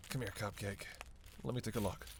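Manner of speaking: quiet, gentle check on someone hurt; soft coaxing. Acted. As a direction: coax them over softly. Tending to an injured companion.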